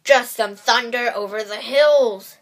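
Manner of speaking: loud, sly wolf voice; sneaky wolf. Acted. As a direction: cover it up with a sly growl. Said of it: "said the sneaky, hungry wolf".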